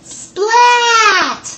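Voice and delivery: high loud cheering shout; excited shout. Acted. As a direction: cheer the winner's name. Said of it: "they all cried".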